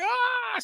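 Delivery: high-pitched mock scream; thin acted shriek; imitated scream. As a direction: squeal like a horror victim, without full volume. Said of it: "She's screaming".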